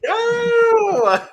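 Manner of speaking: sudden high loud shout. Real, not acted. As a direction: yell no in protest. Shouts protest at outro.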